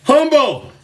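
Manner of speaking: ordinary talk, nothing marked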